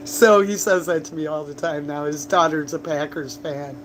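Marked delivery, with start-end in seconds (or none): none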